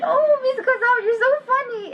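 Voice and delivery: put-on high, delighted child voice; little girl voice. First-grade girl teasing her kind teacher, high delighted child voice. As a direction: imitate a tickled six-year-old girl. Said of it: "and she went".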